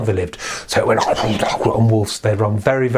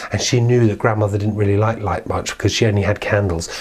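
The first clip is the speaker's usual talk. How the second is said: plain reading voice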